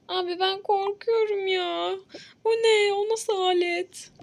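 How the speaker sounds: patient half-crying in fear; whimpering fear. Acted. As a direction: whimper at the sight of the tool. Scared patient seeing tool.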